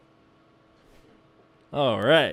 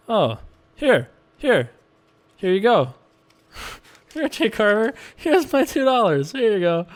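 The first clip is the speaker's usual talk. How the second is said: higher voice, playing the eager giver; high mock offering; 6 semitones higher than usual. Mocking player by handing over two dollars, high voice. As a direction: hand it over like a gushing fan.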